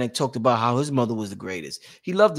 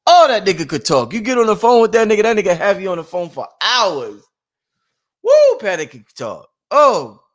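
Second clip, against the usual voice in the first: loud, higher-pitched burst of admiration; excited praise; 5 semitones higher, 8 dB louder than usual. Real, not acted. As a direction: marvel out loud at how he could talk. Fondly hyping how Panic talked.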